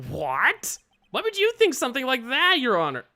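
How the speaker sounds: high-pitched, hammed-up disbelief; shocked protest. Attorney protests to the judge, high shocked protest. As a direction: protest in rising astonishment.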